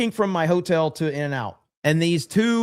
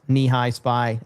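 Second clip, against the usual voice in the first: usual speaking voice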